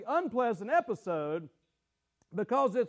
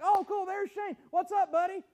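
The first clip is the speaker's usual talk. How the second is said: higher, bright friendly hello to a friend; cheery greeting; 8 semitones higher than usual. Retold or imitated. Pastor happily greeting Shane at church, cheery hello. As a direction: greet him like nothing's wrong. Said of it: "And I'm like".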